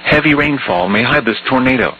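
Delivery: ordinary talk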